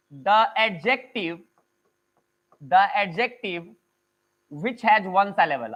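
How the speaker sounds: very slow, spaced-out words; dictating at writing speed; slow dictation. Real, not acted. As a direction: dictate it phrase by phrase, with long pauses. Teacher dictates a rule slowly.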